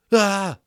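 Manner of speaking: high, loud startled cry; a jolt of terror out loud; sharp gasp of fear. A narrator's reading. Omri realizes they will burn him.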